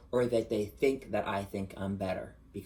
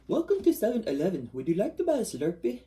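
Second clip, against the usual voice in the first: put-on high, louder Indian shopkeeper accent; 10 semitones higher, 5 dB louder than usual. Showing off accents for Snatch Game, Indian accent. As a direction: greet like an Indian store clerk. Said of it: "I can do accents really good".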